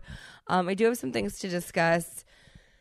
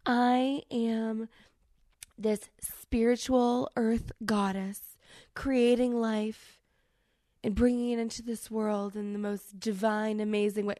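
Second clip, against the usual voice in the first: lightly higher, slow dreamy mock-spiritual voice; mock earth goddess; 4 semitones higher than usual. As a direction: parody a blissed-out pregnant earth goddess, half-hearted. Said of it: "one of those people who was like".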